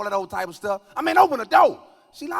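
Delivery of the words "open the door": high, loud demand; yelling at the door. Retold or imitated. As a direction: bang out the demand.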